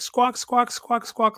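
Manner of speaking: high squawking owl noises; imitated owl squawk. Telling the owl story, high mock squawks. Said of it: "like, staring at him".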